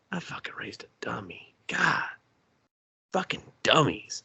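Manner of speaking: whispered, disgusted aside to himself. Retold or imitated. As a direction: mutter it under your breath in disgust. Dad mutters in disgust.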